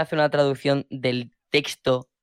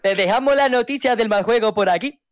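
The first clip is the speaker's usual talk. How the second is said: put-on high, singsong shopkeeper accent; acento de Apu; 7 semitones higher than usual. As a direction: play Apu behind the counter. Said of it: "Con mis amigos parezco, eh, Apu". (acento de Apu, agudo)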